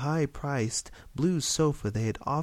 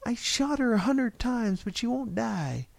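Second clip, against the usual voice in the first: put-on yelling little boy; 12 semitones higher than usual. A narrator's reading. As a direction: pipe up as a boy complaining to mom. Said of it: "he yelled".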